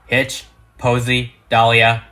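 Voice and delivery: normal talking voice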